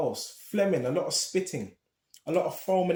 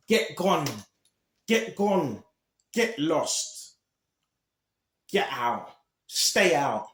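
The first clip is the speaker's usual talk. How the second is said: loud indignant dismissal; mock a demon's indignant "get out"; 4 dB louder, slower than usual. Preacher voicing demons telling people to leave, indignant. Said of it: "expressive of indignation".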